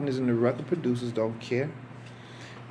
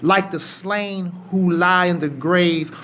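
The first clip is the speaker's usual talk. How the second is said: slow, loud preaching of a psalm, building; fervent scripture reading; 5 dB louder, slower than usual. Acted. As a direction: read the psalm like a preacher pleading with God. Reads Psalm 88 with fervour.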